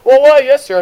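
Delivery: high, loud fake helpfulness; mock obliging clerk. Clerk pretending to oblige an early customer, loudly mock-polite. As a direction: overdo the customer-service eagerness.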